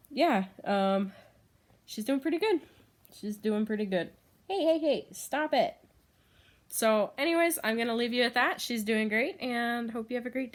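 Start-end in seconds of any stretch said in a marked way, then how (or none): 4.5-5.7 higher, playful scold; higher mild scold; telling off. Real, not acted. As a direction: stop the pet from squirming. Telling hedgehog off.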